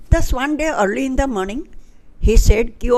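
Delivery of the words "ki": plain reading voice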